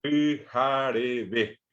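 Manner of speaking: pijarebe very slow, syllable by syllable, ending on BE; stress on last syllable. Shown as a demonstration. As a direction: spell out pi-ja-re-BE, weight on the final vowel. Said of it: "el acento está acá". (pijarebe muy lento, acento en la última sílaba)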